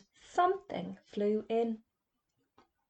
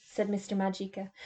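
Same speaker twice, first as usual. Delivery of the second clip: ordinary delivery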